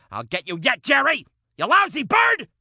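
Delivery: comic furious outburst; angry shout. Acted. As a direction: shake your fist and yell at Jerry. Shouting at grandma's parrot Jerry.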